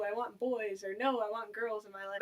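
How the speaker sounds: ordinary talk, nothing marked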